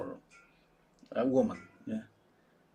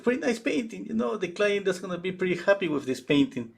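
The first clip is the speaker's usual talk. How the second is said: high gushing praise; 7 semitones higher than usual. Retold or imitated. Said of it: "she said".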